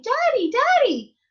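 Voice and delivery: put-on high, loud, drawn-out child's cry; joyful calling out. A narrator's reading. Freed daughters call to their fathers, high joyful cry.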